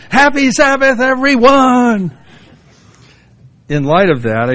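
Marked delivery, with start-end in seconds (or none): 0.1-2.2 high, loud, slow drawn-out DJ shout; booming high DJ welcome to the congregation; radio DJ greeting. Pretend. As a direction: stretch every word like a morning radio host. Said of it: "I feel like saying".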